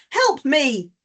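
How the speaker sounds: urgent, emphatic demo cry for help; exclaimed plea. Shown as a demonstration. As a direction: raise the voice into a mild demo shout. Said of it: "a shouting, a raising of the voice".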